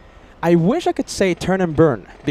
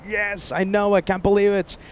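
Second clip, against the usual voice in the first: ordinary talk, nothing marked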